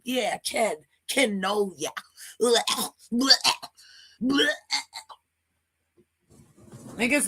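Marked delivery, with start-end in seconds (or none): 2.4-4.5 mock gagging noises; disgusted retching. Real, not acted. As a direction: gag in disgust, short and repeated. Disgusted reaction to the clip.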